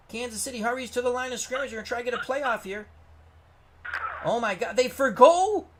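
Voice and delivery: excited play-by-play. Real, not acted. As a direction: call the big play like a stunned commentator. Excited live play-by-play call.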